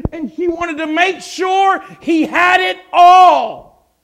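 high, loud, rising preacher's cry; preaching at full force. Acted. Preacher describing the perfume filling the room, shouting with fervor. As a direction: build it to a shout over the congregation.

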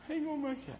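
a dying man barely calling out; weak little voice. Retold or imitated. Dying friend on the phone from hospice, frail and high. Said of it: "this little voice".

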